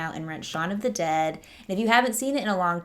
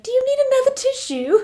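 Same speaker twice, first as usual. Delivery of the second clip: put-on very high, louder, quick sweet-old-lady voice; dotty Barbara; 7 dB louder, faster than usual. Barbara offering her bleeding husband a tissue, squeaky high and chirpy. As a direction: play it as a clueless, cheery mum offering a tissue.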